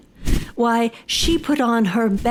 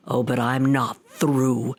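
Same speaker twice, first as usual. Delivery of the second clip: ordinary delivery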